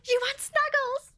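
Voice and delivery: high, eager excited question; thrilled to catch the listener's secret wish. Acted. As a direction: pounce on it with glee.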